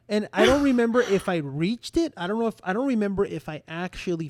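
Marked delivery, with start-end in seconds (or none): none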